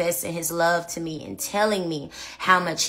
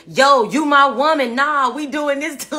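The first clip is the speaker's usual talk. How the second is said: gangster fiancé; 7 semitones higher, 4 dB louder than usual. Retold or imitated. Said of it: "he was really gangster".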